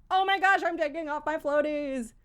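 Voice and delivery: high, loud panicked gasp of a line; mock terror. A nervous swimmer cries out as her floaties come off, in mock terror. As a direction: play the panic of losing the floaties. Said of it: "the terror of".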